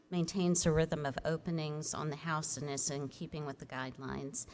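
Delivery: usual speaking voice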